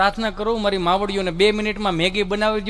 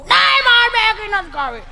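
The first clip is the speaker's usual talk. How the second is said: put-on high, slow, whining child's refusal; whiny spoilt child; 12 semitones higher, 4 dB louder, slower than usual. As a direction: drag it out like a pampered kid. (ઊંચા અવાજે ધીમું રડમસ બાળક)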